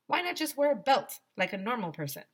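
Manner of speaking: plain reading voice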